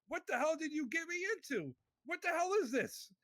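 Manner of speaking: alarmed disbelief, fast; frantic. Retold or imitated. Retelling panic while driving away.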